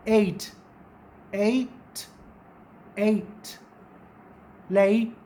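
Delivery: एट and लेट with a clear eh-ee glide; teacher modelling "eight"; diphthong demo. Shown as a demonstration. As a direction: stretch the vowel of एट into ee. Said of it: "इसकी pronunciation भी क्या है".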